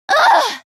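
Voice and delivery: annoyed groan. Acted. Annoyed by enemy voices.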